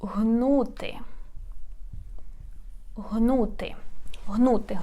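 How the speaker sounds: Гнути repeated softly and slowly, hard h at the start; model pronunciation. Shown as a demonstration. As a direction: say Гнути softly, three times. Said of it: "let me show you the example".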